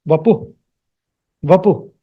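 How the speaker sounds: vapuh in two syllables, final h; actual visarga pronunciation. Shown as a demonstration. Teacher repeats actual pronunciation, vapuh without final hu. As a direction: stop vapuh on the h, no echo. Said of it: "The actual pronunciation is".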